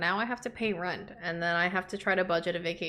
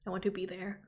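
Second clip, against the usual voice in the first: retold quiet awe; 8 dB quieter than usual. Recalling watching a honeymoon video over dinner, quiet awe. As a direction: say it softly, awestruck, recalling the moment. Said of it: "being like".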